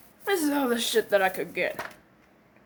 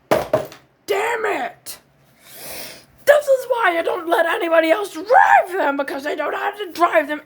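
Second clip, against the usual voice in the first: loud, higher, shouting and half crying; angry outburst; 5 semitones higher, 5 dB louder than usual. Acted. Owner yelling at friend who wrecked his vehicle, loud rant. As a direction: rant at the careless driver.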